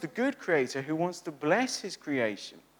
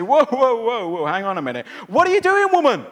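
much louder, much higher shocked husband yelling; alarmed shout; 6 semitones higher, 10 dB louder than usual. Pretend. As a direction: stop her loudly, in alarm. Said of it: "intervene here and say".

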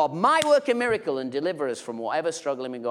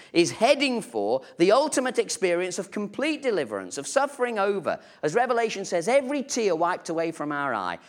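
raised, rousing pulpit voice; full-force preaching; 7 semitones higher, 4 dB louder than usual. Acted. Preacher stirring the church about heaven, rousing. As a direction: lift the congregation with the promise.

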